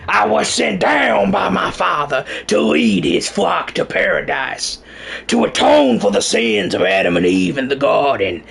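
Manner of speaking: slow, mock southern preacher proclamation, rising in pitch; southern accent. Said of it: "let me turn on my southern accent".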